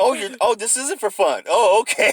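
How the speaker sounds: mock surprise. Quoted realization, retold.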